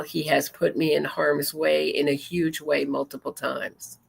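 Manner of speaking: ordinary talk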